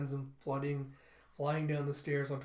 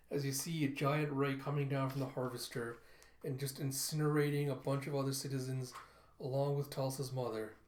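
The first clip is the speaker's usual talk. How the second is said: ordinary talk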